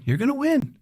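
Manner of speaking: high excited cry of you're gonna win; sudden squeaky cheer. Acted. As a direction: cheer them on to victory. Cheering confidence, huge pitch jump.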